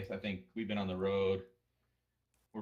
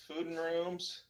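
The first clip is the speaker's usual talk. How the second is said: high-pitched, audible grin; grinning adventurer; 10 semitones higher, 4 dB louder than usual. Acted. Adventurer haggles with the innkeeper, grinning and high-pitched. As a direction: ask cheekily in a raised, grinning voice. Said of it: "grins and says".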